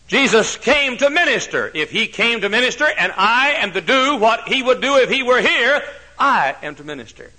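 high, loud pulpit proclamation; full-force preaching. Acted. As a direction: lift it and hammer it home. Preaching peak.

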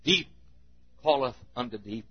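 low, soft, solemn scripture line; hushed refrain. Real, not acted. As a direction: let the verse fall almost away. Hushed refrain after a loud climax.

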